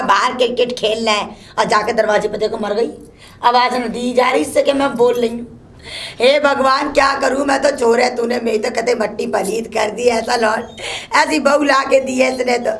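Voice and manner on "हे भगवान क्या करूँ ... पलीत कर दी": ordinary talk, nothing marked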